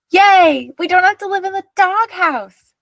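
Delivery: high, loud joyful yell. A narrator's reading. Marta cheering that Mom smiled, loud joyful yell. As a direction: cheer it out with relief. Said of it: "yelled Marta".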